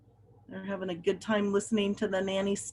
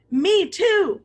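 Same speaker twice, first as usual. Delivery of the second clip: high, louder eager reply; eager chirp; 9 semitones higher, 7 dB louder than usual. A narrator's reading. Teacher voices the penguin eagerly tagging along, high. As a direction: jump in keen to join. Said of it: "little penguin said".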